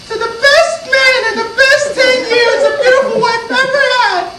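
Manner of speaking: high falsetto, singsong wife voice; wife's toast. Acted. Wife toasts her husband on their anniversary, high mock-female voice. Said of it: "she toasts".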